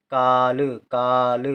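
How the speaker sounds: काल said slowly with a long drawn-out का; long vowel. Shown as a demonstration. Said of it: "का देर तक". (काल में का लंबा खींचकर)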